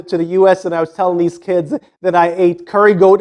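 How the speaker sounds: usual speaking voice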